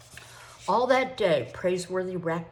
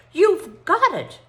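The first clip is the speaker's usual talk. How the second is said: slow, much higher and louder cry; excited; 12 semitones higher, 12 dB louder, slower than usual. Acted. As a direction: burst out with glee. Jack excited by his idea.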